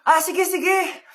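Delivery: normal talking voice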